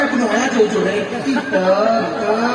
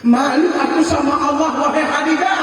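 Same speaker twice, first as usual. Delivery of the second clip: louder cry of shame; anguished outcry; 4 semitones higher, 4 dB louder than usual. Acted. Husband crying out his shame before God to his wife. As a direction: let the shame break out.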